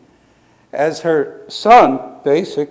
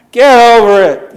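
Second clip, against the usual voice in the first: higher, louder, punched "Get over it"; emphatic punchline; 6 semitones higher, 9 dB louder than usual. Acted. Preacher repeating Marcella's line to the congregation, loud. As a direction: hammer the words "Get over it" home.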